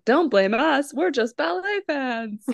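high, lightly voiced aliens; a hint of sheepish aliens. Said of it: "the aliens go".

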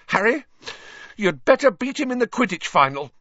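high, rapid, urgent demand; shrill. A narrator's reading. Hermione ordering Harry to beat Malfoy, shrill. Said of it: "Hermione said shrilly".

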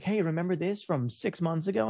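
lightly voiced chirpy app prompt; a hint of a cheery reminder; mock app voice. Social media nags the user, lightly cheery voice.

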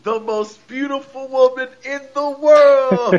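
high, slow, vain diva voice; mocking Carmella bragging about herself; imitating Carmella. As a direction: play the self-adoring heel, soaring up. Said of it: "and Carmella said".

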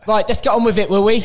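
Ant Middleton. Retold or imitated. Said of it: "I'll do the rest of the podcast as Ant".

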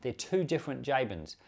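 usual speaking voice

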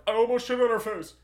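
high, loud, fast panicked blurt; hammed-up frantic yell; frantic confession. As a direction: rush it out in a panic. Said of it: "And I'm like".

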